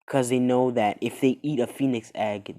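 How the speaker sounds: ordinary delivery, nothing marked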